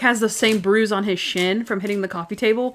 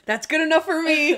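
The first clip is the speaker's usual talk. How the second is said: acted rescue line; 8 semitones higher, 3 dB louder than usual. Partner answers the code word, acted.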